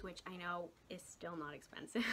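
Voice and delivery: soft confiding whisper under the breath; whispered aside. Real, not acted. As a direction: drop to a quick sheepish whisper. Self-aware aside about price.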